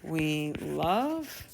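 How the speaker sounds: very slow, drawn-out words with long pauses; composing aloud while writing. Shown as a demonstration. Teacher drafting a story's lesson in a journal, slow and halting. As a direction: feel out each word as you write it.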